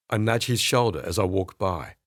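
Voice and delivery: plain reading voice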